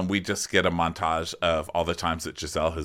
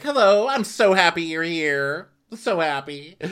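fake-happy, high sing-song greeting; Wendy; 8 semitones higher, 3 dB louder than usual. Wendy greeting guests she can't stand, insincerely gushing. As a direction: greet sweetly while hating them. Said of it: "in the Wendy, I hate you way".

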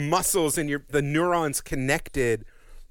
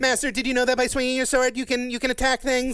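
anime robot maid=104; 8 semitones higher than usual. Retold or imitated. Mocking Fi again.

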